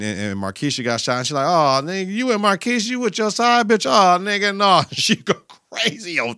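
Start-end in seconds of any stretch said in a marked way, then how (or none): 1.5-4.8 LaWanda's outburst. Retold or imitated. Retelling LaWanda catching T with Markeisha, high accusing voice. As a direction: shriek it like a jealous girlfriend.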